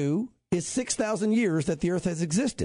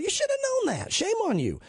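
rebuking; 13 semitones higher than usual. Acted. Voicing the Lord rebuking.

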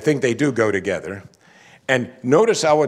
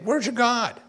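mocking taunt; 11 semitones higher than usual.

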